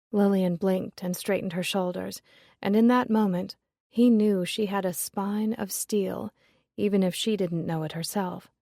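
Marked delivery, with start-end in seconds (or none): none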